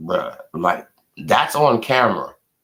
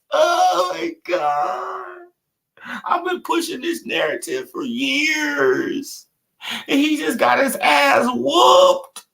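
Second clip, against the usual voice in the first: much higher, crying-shouting, drawn out in triumph; ecstatic half-crying yell; slower than usual. Real, not acted. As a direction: wail with joy like you can't believe it. Overjoyed shouting at the rival's loss.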